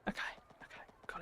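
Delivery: whispered okay, okay. Real, not acted. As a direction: breathe it under your breath. Quiet aside while building.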